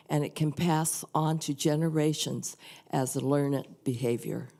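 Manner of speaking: usual speaking voice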